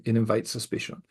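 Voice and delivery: ordinary delivery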